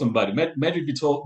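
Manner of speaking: normal talking voice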